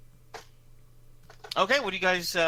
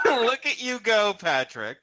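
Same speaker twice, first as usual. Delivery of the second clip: higher, louder, laughing through the words; excited laughing praise; 11 semitones higher, 8 dB louder, slower than usual. Real, not acted. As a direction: cheer the player's big roll while cracking up. GM reacts to a great roll.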